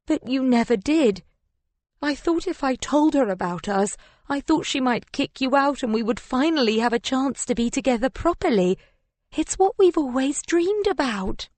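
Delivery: high, plaintive self-justifying whine; whining. A narrator's reading. Mistress explaining her hopes to her lover, whining. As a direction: plead in a whine, climbing toward the end. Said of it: "I hear the whine in my voice".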